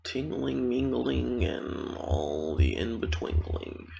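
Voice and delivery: high lilt gliding down, drawn out at the end; playful singsong. Pretend. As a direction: toy with the rhyming words in singsong. Playful rhyming promise to listeners.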